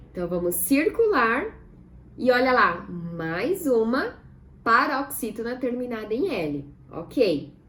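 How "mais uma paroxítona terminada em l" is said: ordinary talk, nothing marked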